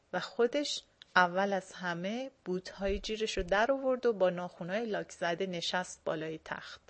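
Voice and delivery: ordinary delivery, nothing marked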